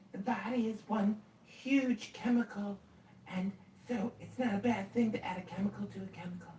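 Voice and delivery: high mock voice imitating him; high mock impression of the guy; mocking their logic. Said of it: "Like, that's their logic".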